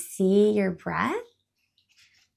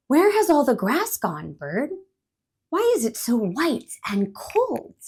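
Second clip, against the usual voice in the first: higher, louder puzzled squirrel voice; the squirrel asks bird, confused about snow; worried squirrel; 4 semitones higher, 4 dB louder than usual. Acted. Squirrel voice in story.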